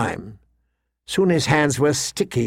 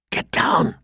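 hushed, urgent whisper; 3 semitones higher than usual. A narrator's reading. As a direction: whisper it fast as a warning. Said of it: "Will whispered urgently".

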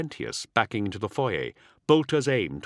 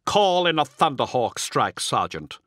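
commanding reply; 4 semitones higher, 3 dB louder than usual. A narrator's reading. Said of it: "Luthris replied".

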